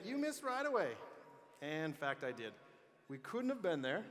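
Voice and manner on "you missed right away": high, loud imitation of fans calling out; mock heckle. Speaker voicing fans who say he missed, mock heckle. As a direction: call it out like a heckler in the stands.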